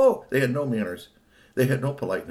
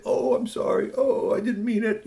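high, pleading apology; feeling bad; 13 semitones higher, 3 dB louder than usual. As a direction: apologize in a high, guilty whine. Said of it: "you would feel kind of bad".

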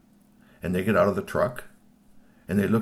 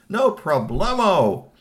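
loud, stretched-out, high flourish; hammed-up breezy confidence; confident boast; 9 semitones higher, 6 dB louder, much slower than usual. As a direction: sing out the cocky catchphrase slowly. Character boasts he can do it.

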